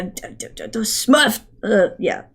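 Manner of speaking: ordinary talk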